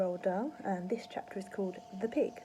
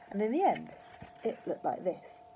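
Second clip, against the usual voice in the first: plain reading voice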